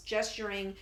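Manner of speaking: ordinary delivery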